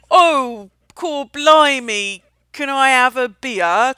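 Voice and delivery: terrible mock Cockney, loud, drawn-out vowels. Customer showing off to a bartender, bad fake Cockney accent.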